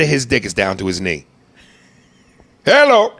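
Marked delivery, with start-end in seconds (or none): 2.6-3.1 high, loud exclamation; a big incredulous hello; mock surprised hello. Real, not acted. Exclaiming at dick size joke.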